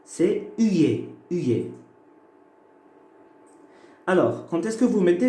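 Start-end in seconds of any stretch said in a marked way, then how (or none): 0.6-1.7 uye as a gliding semi-vowel, repeated; semi-vowel demo. Shown as a demonstration. Teacher introduces the third semi-vowel, modelling it. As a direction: model uye carefully for the class. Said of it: "Et le troisième".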